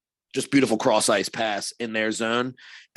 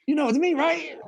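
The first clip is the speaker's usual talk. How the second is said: high, needling taunt in a put-on voice; mock-cocky. Player taunting the goalie he just knocked down, high smug taunt. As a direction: tease him with a smug sing-song. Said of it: "I was like".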